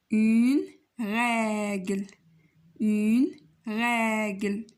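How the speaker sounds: slow, drawn-out word-by-word dictation; slow model reading. Shown as a demonstration. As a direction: read each word slowly for learners to repeat.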